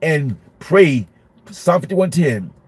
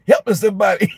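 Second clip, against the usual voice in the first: higher-pitched, hammed-up cry for help; mock desperate plea; 5 semitones higher, faster than usual. Overwhelmed teacher escaping to the bathroom, mock desperate plea. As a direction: plead for rescue in comic despair.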